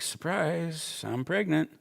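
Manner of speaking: high, singsong mock surprise; wife's surprise announcement. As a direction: play the wife springing the news. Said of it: "my wife's like".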